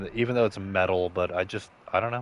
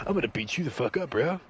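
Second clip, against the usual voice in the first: tough guy threat; 6 semitones higher, faster than usual. Retold or imitated. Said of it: "he's just like".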